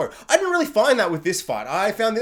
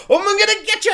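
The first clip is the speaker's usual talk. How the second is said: much higher, hammed-up threat; mock villain yell; 13 semitones higher, 4 dB louder, faster than usual. Imitating Vader yelling at the fleeing ship. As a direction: ham up a cartoon villain's threat.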